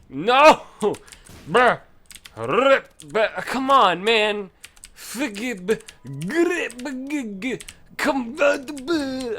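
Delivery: high, loud outraged yells; frustrated yelling. Real, not acted. Gamer raging at his run dying, loud high yelling. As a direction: blow up at the game in real rage.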